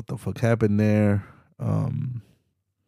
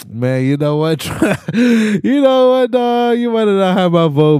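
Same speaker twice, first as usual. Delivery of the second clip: high, loud whoop breaking into laughter; laughing hype; 11 semitones higher, 6 dB louder than usual. Retold or imitated. Cheering on Trump's attack on Elon, laughing hype. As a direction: crow it through laughter, voice shooting way up.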